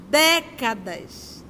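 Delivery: slow, stretched décadas twice; slow weighty repetition; drawn-out repetition. Real, not acted. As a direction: let each décadas hang long. Preacher lingering on a word.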